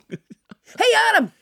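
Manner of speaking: high-pitched call across to someone. Acted. Co-host hailing the host mid-show, calling out high. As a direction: holler to get his attention. Calling Adam over to the phone.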